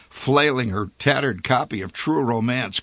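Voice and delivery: plain reading voice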